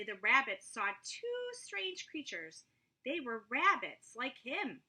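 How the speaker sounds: usual speaking voice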